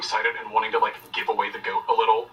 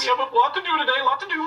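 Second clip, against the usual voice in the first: high, quick, bustling grandma; Aunt Patty; 12 semitones higher, faster than usual. Acted. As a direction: hurry them along in a high auntie voice. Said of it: "I'm Aunt Patty now".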